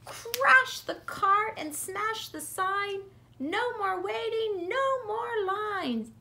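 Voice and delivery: high, slow, stomping tantrum; tantrum peak. A narrator's reading. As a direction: hammer each line like a furious toddler.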